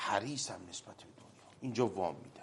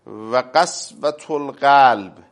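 drawn-out, louder Arabic recitation; slow loud recitation; 3 semitones higher, 16 dB louder, much slower than usual. Real, not acted. Cleric reciting hadith to host, slow and loud. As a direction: intone each word slowly and firmly.

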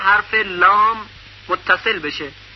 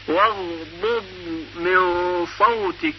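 slow recitation; 4 dB quieter, slower than usual. Shown as a demonstration. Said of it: "یا در کلمهٔ".

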